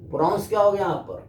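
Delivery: usual speaking voice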